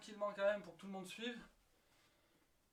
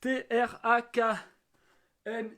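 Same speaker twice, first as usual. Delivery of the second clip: slow letter-by-letter spelling; spelled out slowly; 9 dB louder, slower than usual. Shown as a demonstration. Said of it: "Track mix".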